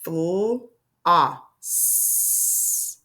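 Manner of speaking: "Fl-, ah, s" slowly pulled apart into sounds; sounded out. Shown as a demonstration. Teacher letting pupils hear it again, sound by sound. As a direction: stretch floss as "Fl-", "ah", long "s". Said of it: "let's hear that again".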